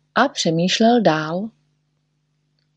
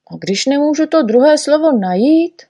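Neználek; 8 semitones higher, 4 dB louder than usual. A narrator's reading.

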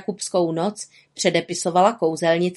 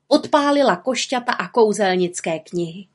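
ordinary delivery

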